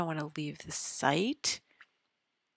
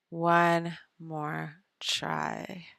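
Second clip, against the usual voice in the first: drawn-out resolve; 3 dB louder, much slower than usual. Real, not acted.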